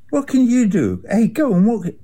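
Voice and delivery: "What can you do?" jumps high, exasperated; exasperated high cry. Real, not acted. Venting frustration about Israel, exasperated high cry. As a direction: throw up your hands, voice shooting up.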